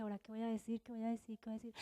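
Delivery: very soft, low self-talk; worried inner voice. Retold or imitated. Woman waiting last at the meeting, worried and hushed. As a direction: fret to yourself under your breath. Anxious inner voice before her turn.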